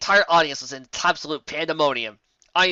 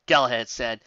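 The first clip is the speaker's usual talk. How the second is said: usual speaking voice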